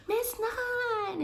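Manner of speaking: put-on high, bright kid's call; child calling out. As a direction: call her name like an eager little boy. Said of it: "he was like".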